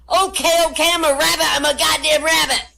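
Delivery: high frantic shouting; panicked yelling. Acted. Panicked mountain lion yelling.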